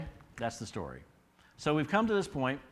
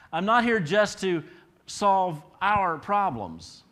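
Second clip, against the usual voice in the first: loud, slow, every word driven home; Jesus declaring his purpose, loud and drawn out; emphatic proclamation; 7 semitones higher, 9 dB louder, much slower than usual. Acted. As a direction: preach it out, slowing right down.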